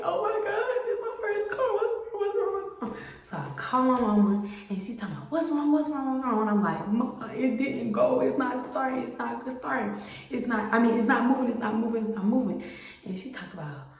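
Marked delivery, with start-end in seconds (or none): 0.0-3.0 high, fast, frantic squeal; panicking over a dead first car; rapid high-pitched alarm, repeating in fear; panicked. Retold or imitated. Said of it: "I start panicking". 5.3-6.4 a hint of an alarmed mother, higher; lightly voiced worried mother on the phone; worried mom. 6.9-12.6 high, pleading, distressed telling; begging mom for help, higher; panicked. Retold or imitated. Daughter panicking to mother.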